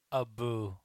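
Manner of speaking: usual speaking voice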